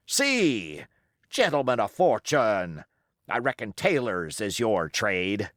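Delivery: a scornful pirate captain holding his ground; defiant old sea-cook pirate. Acted. Pirate cook rebutting the mutineers' charges, defiant. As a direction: roll the words out like a seasoned buccaneer defending his command. Silver scolds the crew.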